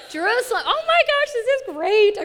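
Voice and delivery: loud, high-pitched delight; gushing, over-the-top praise, way up high; excited cheering. Real, not acted. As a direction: cheer on a right answer at full pitch. Cheers the audience's correct answer.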